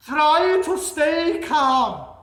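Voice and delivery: hammed-up Santa reassuring. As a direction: boom it out to calm everyone. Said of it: "says Santa".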